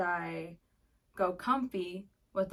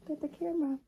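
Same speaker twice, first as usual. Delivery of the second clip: voicing the puppy's thoughts, pitched higher; voicing the dog; 7 semitones higher than usual. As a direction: speak as the cute puppy. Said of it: "He's like".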